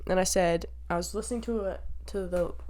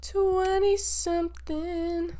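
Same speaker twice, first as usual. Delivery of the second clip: playful singsong; 10 semitones higher, much slower than usual. Acted. Playful high drawn out reply.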